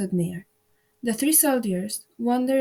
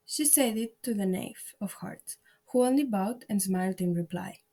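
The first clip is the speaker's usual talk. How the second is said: ordinary delivery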